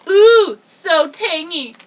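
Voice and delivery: hammed-up ad read, stretched slow; gushing TV ad voice; commercial voice.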